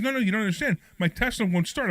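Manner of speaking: retold worried plea; imitated panic. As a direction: imitate a friend insisting in a mild panic. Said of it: "He's, like, really in a panic".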